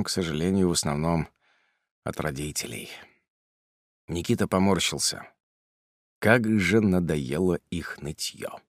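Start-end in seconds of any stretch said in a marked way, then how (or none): none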